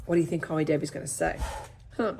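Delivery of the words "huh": ordinary delivery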